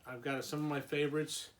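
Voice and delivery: usual speaking voice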